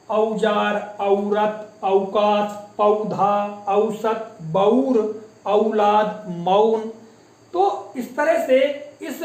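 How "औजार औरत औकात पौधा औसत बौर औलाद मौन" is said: each word apart, औ opened into 'a-u', as in औरत 'a-u-rat'; diphthong demonstration. Shown as a demonstration. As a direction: stretch the 'a-u' glide in बौर and मौन. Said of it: "तो इसका उच्चारण कैसे होगा".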